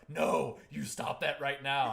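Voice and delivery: loud, slow mock reprimand; retold scolding. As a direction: imitate someone firmly shutting it down. Retold scolding from a scene partner.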